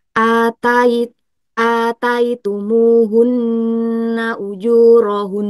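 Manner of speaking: slow chanted recitation, n in "ataitumuhunna" hummed long; long held ghunnah. Shown as a demonstration. Teacher demonstrating Quran recitation, slow with long hummed n. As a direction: hold the n of "ujurahun" in a long nasal hum. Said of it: "jadi ditahan dengan gunah".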